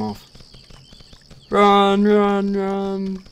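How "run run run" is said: panicked run. Real, not acted. Ogres pissed off.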